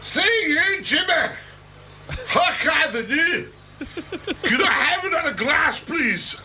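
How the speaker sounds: high-pitched, hammed-up Scottish accent. Shown as a demonstration. Presenter showing off his English as a joke, Scottish accent. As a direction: put on a comic Scottish voice, pitched right up. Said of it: "How's that, a bit Scottish?".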